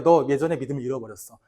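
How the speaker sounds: soft, low, quick reproach; a hint of a scolding wife; lightly voiced wife's rebuke. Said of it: "그 아내가 그래요". (아내가 남편을 낮고 빠르게 나무람)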